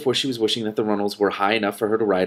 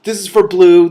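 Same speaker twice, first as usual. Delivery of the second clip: bright, high, loud hello to listeners; bubbly opening to the audience; excited greeting; 9 semitones higher, 10 dB louder than usual. Real, not acted. Host greeting her listeners with excitement. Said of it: "Excited Enchanted Forest Adventures".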